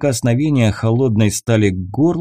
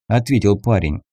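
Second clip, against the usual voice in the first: ordinary delivery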